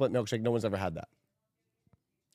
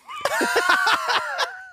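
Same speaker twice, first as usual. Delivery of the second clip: shouted laugh. Real, not acted. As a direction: explode into laughter. Loud laughter ending the game.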